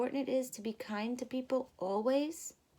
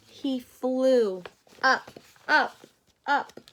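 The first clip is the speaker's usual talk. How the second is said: 1 rising excitement; 10 dB louder, much slower than usual. A narrator's reading. Flight told rising.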